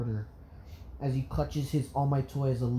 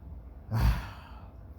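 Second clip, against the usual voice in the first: heavy, breathy exhale; doctor's sigh; 4 semitones lower, 3 dB quieter than usual. A narrator's reading. Doctor sighing before the next patient, weary sigh. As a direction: let out a long sigh. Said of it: "The doctor sighing".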